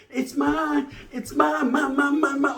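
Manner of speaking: put-on high, childish mine mine mine; mock a greedy kid clutching his money. Mocking Wes for keeping all the money, high and childish.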